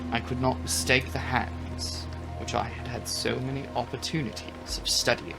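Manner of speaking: ordinary delivery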